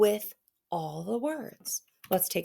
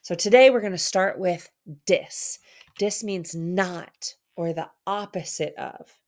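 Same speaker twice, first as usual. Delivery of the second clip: ordinary talk, nothing marked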